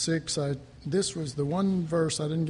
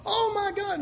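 high, laughing gasp of discovery; amazed realization. Retold or imitated. Preacher retelling his revelation, high and laughing. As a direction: let the wonder burst out with a laugh. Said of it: "I went".

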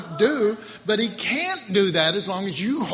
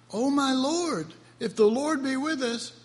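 compassionate reading; 7 semitones higher than usual. Shown as a demonstration. Preacher reads the verse for Gideon's sake, with compassion. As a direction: read it gently, pitched up, with pity for Gideon. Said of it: "tried to read this one with compassion".

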